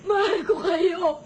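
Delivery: slow, sobbing cry of love; crying shout. Acted. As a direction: break down shouting it. Mother cries out her love. (humahagulgol na sigaw)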